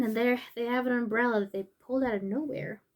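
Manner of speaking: plain reading voice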